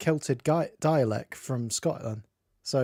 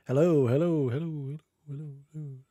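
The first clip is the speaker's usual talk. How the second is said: echoing hellos. Retold or imitated. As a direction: let hello echo away into nothing. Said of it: "it doesn't sound like I'm going".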